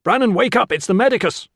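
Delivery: loud, high rousing shout; desperate yell. A narrator's reading. Medicus trying to wake a boy amid attackers, yelling. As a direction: yell to wake someone across a brawl. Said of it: "he yelled".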